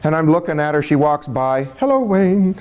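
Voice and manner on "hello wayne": higher, singsong nurse greeting; passing nurse. Retold or imitated. Nurse walking by the patient, higher-pitched greeting. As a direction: lightly imitate a chirpy hello, pitched up.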